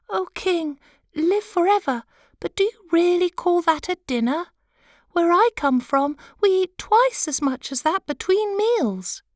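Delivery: a tiny sea animal piping up boldly to the king; squeaky sea creature. Acted. Sea animal speaks again.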